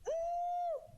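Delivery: squealed high teasing ooh; a sudden high mocking ooh; high taunting ooh. Real, not acted. Teasing exclamation.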